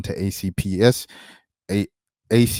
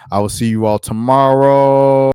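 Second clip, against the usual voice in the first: loud sign-off; 3 semitones higher, 11 dB louder than usual. Real, not acted. Sign-off call to viewers.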